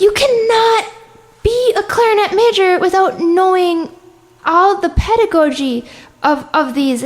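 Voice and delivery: high-pitched indignant scolding, easing off toward the end; mock indignation. Clarinetist retells scolding a clarinet major, mock-indignant. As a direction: lay down the law, playfully appalled. Retold scolding of younger player.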